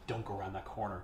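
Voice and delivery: low, hushed mock warning; quiet warning. Passerby warning a woman in the market, quiet warning. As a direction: drop it low and soft. Stranger warns woman in retold story.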